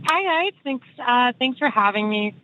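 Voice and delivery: shaky voice, nervous caller; a nervous tremble in the voice. Real, not acted. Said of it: "if I sound a little shaky in my voice".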